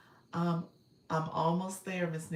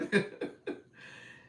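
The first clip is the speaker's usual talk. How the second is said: breathy laugh. Real, not acted. Laughing after the quote.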